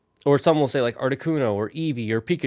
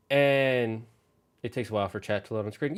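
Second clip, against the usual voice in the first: normal talking voice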